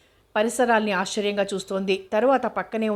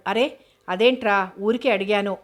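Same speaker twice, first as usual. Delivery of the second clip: ordinary delivery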